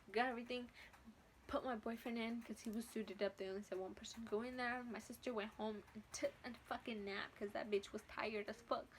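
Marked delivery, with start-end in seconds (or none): none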